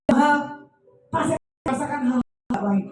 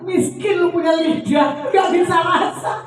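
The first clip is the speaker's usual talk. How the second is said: mocking retort; 7 semitones higher, 4 dB louder than usual. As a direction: drag it out as a gleeful taunt. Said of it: "gua bilang".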